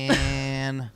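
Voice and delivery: "Dan" with an endlessly held Syracuse A; exaggerated upstate Syracuse "Dan"; Syracuse accent. Shown as a demonstration. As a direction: stretch the vowel of "Dan" very long, Syracuse style. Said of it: "the letter A goes on forever".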